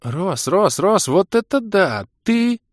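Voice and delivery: high, loud, excited squeal; yelling in amazement. A narrator's reading. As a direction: build up to a thrilled cry. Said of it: "Хватит вопить".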